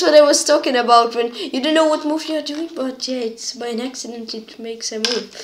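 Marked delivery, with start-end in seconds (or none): none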